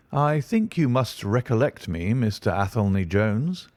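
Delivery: plain reading voice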